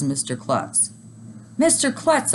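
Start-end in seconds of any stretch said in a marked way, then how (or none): 1.6-2.3 high startled shout of the name. A narrator's reading. Boy spotting his principal as Santa, startled shout. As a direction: call out in shock. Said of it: "I shouted".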